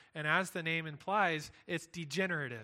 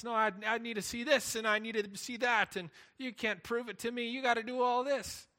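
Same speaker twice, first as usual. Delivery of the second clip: higher, louder, insistent demanding voice; whiny mock demand, rising higher; demanding skeptic; 5 semitones higher, 4 dB louder than usual. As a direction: play a stubborn skeptic insisting on proof. Voicing Pharisees demanding proof.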